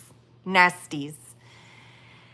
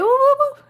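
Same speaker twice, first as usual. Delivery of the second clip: whooping. Real, not acted. Streamer reacting to the live chat, whooping. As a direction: whoop it out high.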